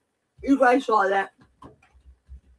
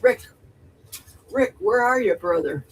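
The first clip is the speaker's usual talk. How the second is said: usual speaking voice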